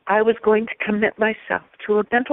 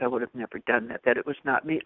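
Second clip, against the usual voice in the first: hushed puzzled psychiatrist; flat denial; 8 semitones lower, 8 dB quieter than usual. Retold or imitated. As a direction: lower and softer, a quiet denial. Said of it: "and he said".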